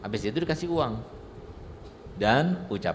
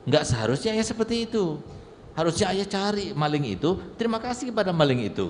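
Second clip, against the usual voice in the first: put-on much higher, eager child voice; a clever son lectures his father, higher and eager; 8 semitones higher than usual. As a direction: chirp it like a bright, cheeky boy. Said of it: "Apa kata anak tersebut?".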